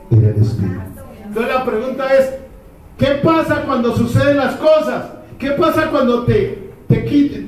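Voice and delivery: rising pulpit fervor; preaching. Acted. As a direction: hammer each qué pasa at the congregation. Preacher raising his voice.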